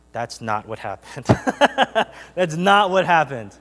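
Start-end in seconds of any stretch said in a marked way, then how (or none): 1.3-2.3 high, loud burst of laughter. Real, not acted. Preacher amused by the gentle-mom idea, real laughing.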